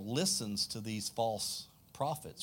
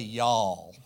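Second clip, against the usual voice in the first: Southern y'all; 5 semitones lower, 3 dB louder than usual. Shown as a demonstration. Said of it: "You can probably hear it in my accent".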